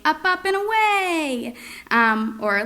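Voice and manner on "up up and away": high, slow sing-song; sing-songy. Shown as a demonstration. Parent on the trampoline with a child, high sing-song. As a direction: stretch it out high and playful. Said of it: "when you're jumping on the trampoline".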